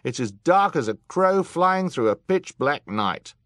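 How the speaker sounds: high, loud complaint; yelled. A narrator's reading. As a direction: keep yelling over the crowd. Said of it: "someone yelled".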